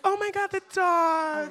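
high, slow "Oh my God, the dog"; mock coworker gushing at a pet story; gushing over the dog. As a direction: coo like a dog-mad coworker. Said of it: "they will just be like".

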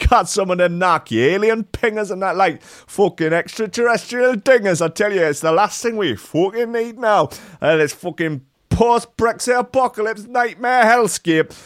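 high-pitched rough British chav voice, hammed up; mock British chav caller bragging about footage; British chav accent. Host reading a YouTuber's post in mock British chav accent. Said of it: "I didn't do the accent".

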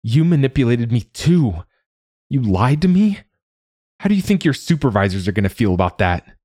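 angry accusation rising in pitch; anger let loose. Acted. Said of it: "allowed him to set that anger loose".

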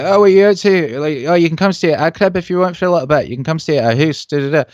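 high-pitched imitation of a Scottish vagrant; Scottish impression, pitched well up; Scottish accent. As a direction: put on a lively Scottish accent. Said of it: "were Scottish. This guy's like".

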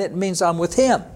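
ordinary talk

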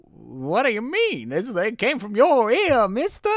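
a put-on squeaky clown protest. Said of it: "And he's like".

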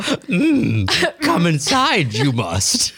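high, goofy alien-sage impression; hammed-up space-guru voice; sci-fi voice. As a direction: play a silly sci-fi creature, starting high. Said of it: "you wanna do sci-fi?".

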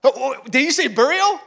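high, loud double take; startled disbelief. Acted. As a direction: stop short and question it, stunned. Peter startled, record scratch moment.

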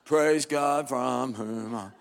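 slow, dull dragging drone; bovine drone. Retold or imitated. Congregation droning the doxology, slow and dull. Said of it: "drone through it with our usual bovine enthusiasm".

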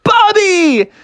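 high, excited call of the name; crowd's cry. Acted. Onlookers spot the flying bear, high excited cry. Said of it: "And everybody was like".

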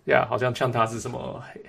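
ordinary delivery

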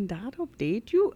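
country Canadian accent. Shown as a demonstration. Co-host trying out host's phrase, in a country Canadian accent. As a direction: try country Canadian, rising on "you".

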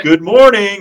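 high, loud, overjoyed mock greeting; mock cheery greeting. Mocking overly friendly teammates texting each other, high and loud cheery greeting. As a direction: chirp it like a gushing best friend. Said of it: "they text each other, like".

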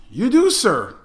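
high, loud mock deference; exaggerated obliging. Sergeant answers the demanding second lieutenant, mock obliging. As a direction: play up the eager-to-help sergeant.

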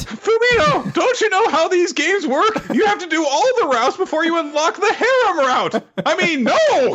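very high, hammed-up ranting; mock exasperated outburst. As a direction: squeal it like an indignant game nerd. Joke outburst as game-obsessed boy.

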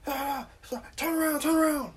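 high squeaky mock cop voice. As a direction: make the trooper sound silly and high. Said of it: "He's like".

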